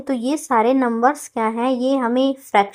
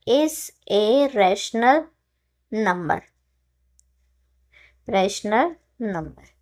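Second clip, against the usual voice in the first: slow dictation; much slower than usual. Shown as a demonstration. Teacher to students writing, slow dictation. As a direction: spell it out word by word.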